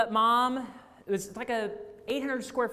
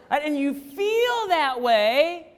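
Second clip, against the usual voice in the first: ordinary talk, nothing marked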